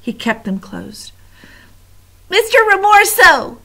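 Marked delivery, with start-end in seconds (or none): 2.3-3.6 high, loud desperate shout; panicked mouse shout. Acted. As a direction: call out in terror. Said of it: "he shouted".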